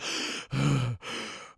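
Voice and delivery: heavy breathy huffs, acted; heart-attack panting. Older cop left shaken by his partner, heavy mock huffing. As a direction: huff out air as if overwhelmed. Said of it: "looks like he's having a fucking heart attack".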